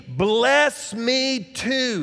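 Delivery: long, high pleading cry; dramatic, drawn-out bitter cry. Acted. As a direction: stretch each word in a pleading wail. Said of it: "he cried".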